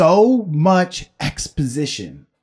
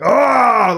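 loud, high mock roar; grizzly bear roar; 9 semitones higher, 4 dB louder than usual. Showing the co-host how to swarm someone, grizzly roar. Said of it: "like a grizzly bear".

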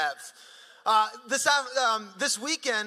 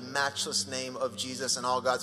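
prayer; 6 semitones lower than usual. Acted. Pastor prays over the congregation, low and reverent.

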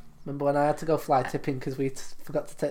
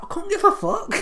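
defiant laughing; 4 dB louder than usual. Retold or imitated. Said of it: "I was like".

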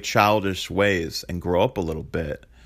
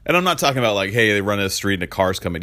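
normal talking voice

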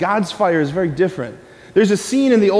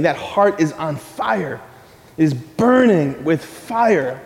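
ordinary talk, nothing marked

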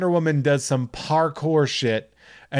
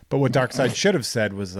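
usual speaking voice

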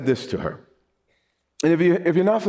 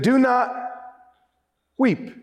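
slow, drawn-out do not weep, pitched higher; Jesus easing a grieving mother, slow and tender; gentle command; 9 semitones higher than usual. Retold or imitated. Jesus speaking to the widow at the funeral, slow and gentle. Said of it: "came to this woman and said".

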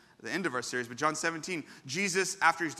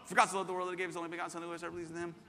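fast recitation; much faster than usual. Shown as a demonstration. Pastor racing the congregation through John three sixteen, rapid-fire recitation. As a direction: rattle the verse off at double speed. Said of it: "Let's say it as fast as we can".

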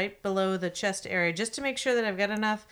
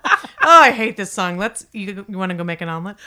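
mock concert goer; 9 dB louder than usual. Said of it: "Says every concert goer".

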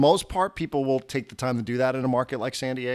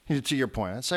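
ordinary delivery

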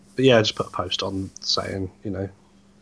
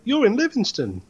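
put-on Scottish accent; 12 semitones higher than usual. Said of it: "That was Scottish".

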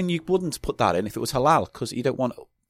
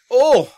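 sour shock yelp; 12 semitones higher, 10 dB louder than usual. Real, not acted. Tasting extremely sour candy.

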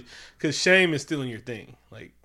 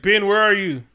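mock calling out; 6 semitones higher, 7 dB louder than usual. Reed calling out.

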